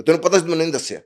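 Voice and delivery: loud, high-pitched mock reproach; retold scolding. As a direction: imitate a hurt friend telling you off. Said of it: "وہی ڈانٹ رہا تھا".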